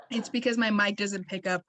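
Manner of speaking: ordinary talk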